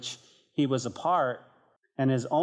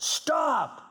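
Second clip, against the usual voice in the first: shouted stop; 13 semitones higher than usual. Retold or imitated. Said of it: "I felt God shout".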